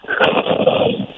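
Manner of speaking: snore that breaks into giggles; laughing snore. Real, not acted. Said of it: "who laughs in their snore".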